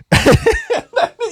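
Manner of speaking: high-pitched burst of laughter. Real, not acted. Laughing at his own bar story, high. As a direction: cackle at the memory. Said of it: "You know where he goes".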